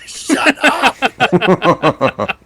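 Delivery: loud laughing shut up. Real, not acted. Laughing at friend's teasing.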